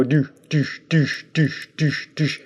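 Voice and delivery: short, evenly spaced "doosh" sounds; imitated movement noise. Shown as a demonstration. Showing how the head joint moves, staccato "doosh" noises. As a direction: make punchy little mouth sound effects.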